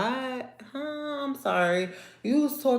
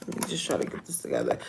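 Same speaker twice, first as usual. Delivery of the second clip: fast, soft muttered whisper; rushed whisper to self; 2 semitones lower, 6 dB quieter, much faster than usual. Real, not acted. Wife whispering to herself to calm down mid-rant. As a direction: hurry it under your breath to yourself.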